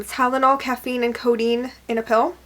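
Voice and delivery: usual speaking voice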